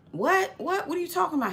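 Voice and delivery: high-pitched, incredulous questioning; mock disbelief. Retold friend hearing the plot against the pastor, high mock disbelief. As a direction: imitate a baffled friend.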